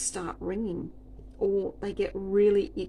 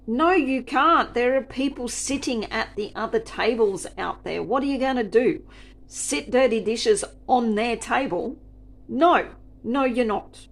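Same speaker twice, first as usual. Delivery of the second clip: much louder and higher, firm no; retold angry refusal; 5 semitones higher, 8 dB louder than usual. Server refusing the rude customer, loud and sharp. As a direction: shut her down hard.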